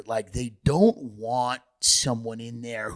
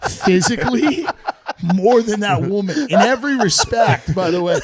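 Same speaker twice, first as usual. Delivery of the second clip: high, loud laughing praise; 6 semitones higher, 4 dB louder than usual. Real, not acted. Mocking the mayor while laughing. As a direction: laugh through the mock praise. Laughing mock tribute to Lightfoot.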